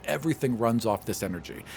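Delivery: ordinary delivery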